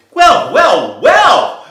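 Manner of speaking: very high, loud amazed exclamation; loud high impressed well well well; impressed birds. Acted. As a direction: crow it out, delighted. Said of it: "said the birds".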